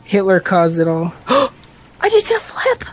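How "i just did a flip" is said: very high, put-on squeal of amazement; falsetto excitement. Player after doing a flip, mock-excited falsetto. Falsetto exclamations over a game stunt.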